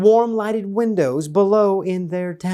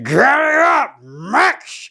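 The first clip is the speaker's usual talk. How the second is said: high shout of giddy up; calling out to Max; 8 semitones higher, slower than usual. A narrator's reading. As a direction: yell to get the dog moving. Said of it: "the Grinch said".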